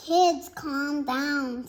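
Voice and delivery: slow, lightly voiced teacher; slow it down to settle the kids; teacher calming. Mister Cooper calming the class, slow and steady. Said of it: "said Mister Cooper".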